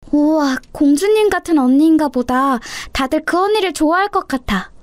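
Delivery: put-on high, wonderstruck child voice; admiring little sister. Little sister hears about a princess-like classmate, admiring. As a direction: marvel like an impressed little girl.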